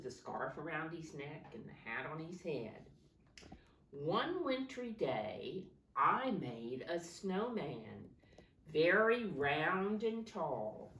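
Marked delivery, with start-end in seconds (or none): 3.9-10.9 slow storybook reading voice; reading to children. Acted. Teacher starting the picture book for children, slow reading. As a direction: read it slowly for the class.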